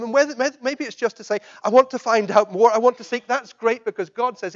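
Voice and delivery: loud, high, ringing proclamation; full-force preaching. Real, not acted. Preacher proclaiming Jesus' words to the congregation, loud and ringing. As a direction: proclaim it to the back of the church.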